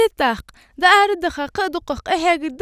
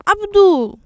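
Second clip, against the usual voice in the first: louder, higher-pitched hail; calling out; 4 semitones higher, 6 dB louder, slower than usual. Acted. One hails Abdu after a pause, calling out. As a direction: call out to get his attention.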